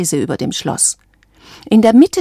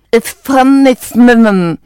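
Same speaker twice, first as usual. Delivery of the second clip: loud, slow muffled mumble; beak tied shut; 5 semitones higher, 8 dB louder, slower than usual. A narrator's reading. Isabella with a band round her beak, muffled. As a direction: force the words through a closed mouth. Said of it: "mit dem Band um den Schnabel auch nicht sprechen konnte".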